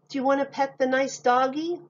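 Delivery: high, coaxing grown-up voice; coaxing adult. Acted. Adult urging a scared child to pet the dog, coaxing. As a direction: sweetly talk the child into it.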